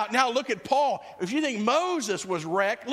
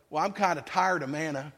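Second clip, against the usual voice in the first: grumbling complainer; 7 semitones lower than usual. Retold or imitated. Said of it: "Human nature eventually goes".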